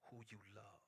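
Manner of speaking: unvoiced, very soft whisper; a whispered sermon line. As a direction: let it fade to a whisper. Whispered ending.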